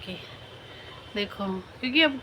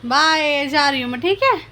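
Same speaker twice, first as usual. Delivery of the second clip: higher, louder playful coo; 4 semitones higher, 8 dB louder than usual. Real, not acted. Mother pretends to leave her giggling baby, in higher, louder baby talk.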